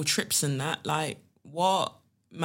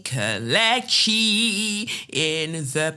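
hyped name drop; 3 semitones higher, 4 dB louder, much slower than usual. Acted. Podcast intro name call.

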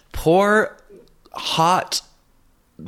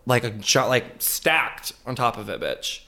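ordinary talk

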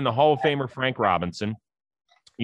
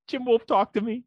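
imitated player; 10 semitones higher than usual. Acted player addressing the umpire.

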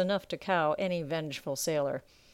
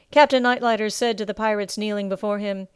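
ordinary delivery

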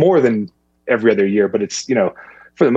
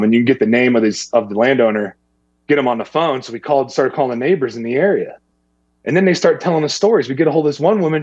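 usual speaking voice

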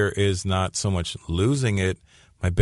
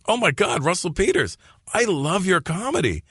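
high-pitched mock fan voice; hammed-up excited fan, high; gushing fan; 10 semitones higher than usual. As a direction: play a starstruck customer, pitched way up. Acts out imagined fan at counter.

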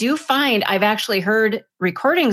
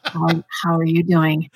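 lowered, mock sultry phone voice; phone sex operator; 4 semitones lower than usual. Shown as a demonstration. Co-host keeps up the teasing bit, phone operator voice. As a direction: play up a low seductive phone greeting. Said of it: "like a phone sex operator".

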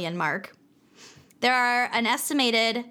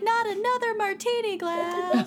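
little ghost voice; 10 semitones higher than usual. Pretend. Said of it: "Their little fish ghosts are like".

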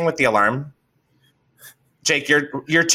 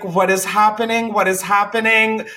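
high-pitched mock panicking; retold panic; 8 semitones higher than usual. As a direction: replay your own alarm in a raised pitch. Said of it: "I'm, like, panicking".

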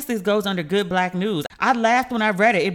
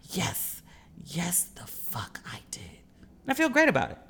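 defiant whisper; 3 semitones lower, 6 dB quieter than usual. Real, not acted. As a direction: hiss it under your breath. Defiant aside after cursing story.

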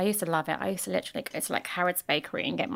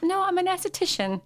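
high, acted-out denial; high-pitched correction; 11 semitones higher than usual. Esthetician correcting client's mistake, high-pitched. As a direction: correct them in a high, innocent voice. Retells own correction to Linda.

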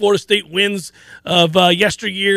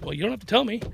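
much softer mock reply; imitated sleepy wife; 2 semitones higher, 9 dB quieter, faster than usual. Host imitates wife's reply from bed, softly. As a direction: softly mimic a wife woken early. Acts out his half-asleep wife.

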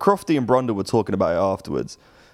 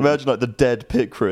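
ordinary talk

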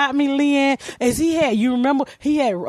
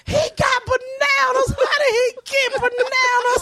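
very high, slow shouted amazement; excited child shout; 13 semitones higher, slower than usual. Retold or imitated. Child yelling at her teacher about the ape's bananas, high shout. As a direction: yell it like a kid who won't leave the cage.

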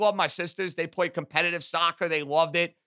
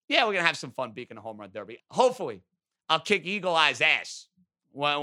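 usual speaking voice

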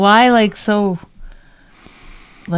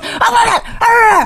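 very high imitated child's wailing; mock childish tantrum; much slower than usual. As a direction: mimic a bratty child's shrieking fit. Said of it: "you're like a child. You're like this".

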